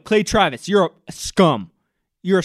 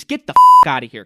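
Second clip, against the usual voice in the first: angry outburst; 3 semitones lower, 9 dB louder, faster than usual. Real, not acted. Ranting at Clay Travis, a sudden loud yell. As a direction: explode at him.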